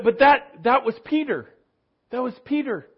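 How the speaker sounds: usual speaking voice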